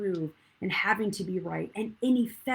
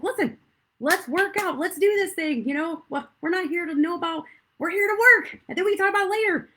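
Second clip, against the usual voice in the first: high, loud and pushy, rushing the end; rally a flagging partner mid-workout; loud pep talk; 11 semitones higher, 8 dB louder than usual. Retold or imitated. Telling her workout partner to keep going, loud and high. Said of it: "but I was like".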